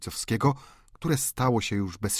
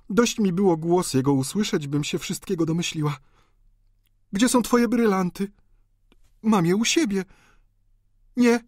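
higher-pitched, tense woman's account; distraught countess; 9 semitones higher, 4 dB louder than usual. Acted. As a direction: relive the confrontation with raised pitch. Countess recounts the scene.

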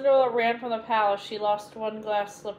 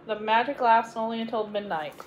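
usual speaking voice

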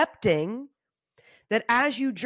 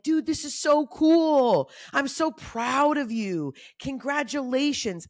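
higher, slow, gushing praise; cheering friends; 6 semitones higher, slower than usual. Retold or imitated. Friends congratulating her, lightly voiced gushing. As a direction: beam with pride for her.

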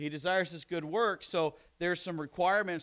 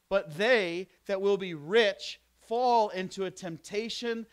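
slow, weighty scripture reading; slow preaching; 4 semitones higher, 5 dB louder, slower than usual. Acted. Preacher reading Timothy to the church, slow and weighty. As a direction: lean on every phrase of the verse.

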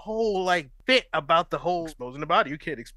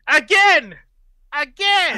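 high, loud mock order; imitated command; 11 semitones higher, 7 dB louder, much slower than usual. Co-host imitating the club owner ordering thrusts, mock commanding shout. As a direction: imitate a demanding taskmaster, voice pitched up. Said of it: "He's like".

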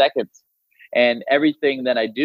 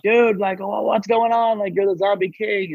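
high, excited schoolmate voice; excited classmates; 8 semitones higher, slower than usual. Retold or imitated. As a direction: gush like an amazed teenager. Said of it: "people were like".

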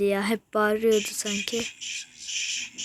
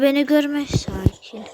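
ordinary delivery

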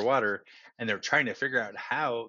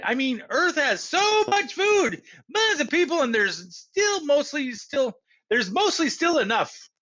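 put-on higher, earnest boy; young boy voice; 12 semitones higher than usual. Arthur, a child, voiced higher.

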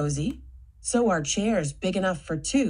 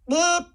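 silly bloop; 9 semitones higher, 4 dB louder than usual. Shown as a demonstration. Silly sound after character voices.

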